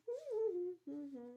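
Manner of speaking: high wordless hum; little girl's high humming. Acted. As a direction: hum tunefully with lips closed.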